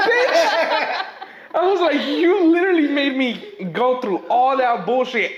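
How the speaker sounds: high, loud, laughing outrage; exasperated outburst. Retold or imitated. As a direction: blow up in disbelief. Exasperated reaction to customer.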